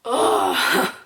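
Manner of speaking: a loud breathy groan of frustration; frustrated ugh. Real, not acted. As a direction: exhale a fed-up ugh. Exasperated at start of story.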